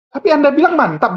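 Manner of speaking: usual speaking voice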